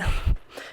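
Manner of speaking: a quick breathy laugh; short laugh. Real, not acted. Laughing at the kid's confused wording. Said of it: "I'm sorry I'm laughing".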